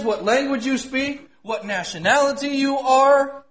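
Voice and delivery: ringing pulpit proclamation; impassioned preaching. Real, not acted. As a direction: preach it high with drawn-out emphasis. Preaches at full force.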